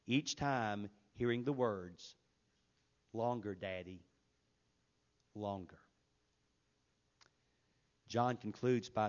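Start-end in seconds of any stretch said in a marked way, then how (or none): 3.1-5.8 soft pleading. Retold or imitated. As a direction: lower and softer, a child pleading gently. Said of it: "in a soft, pleading voice".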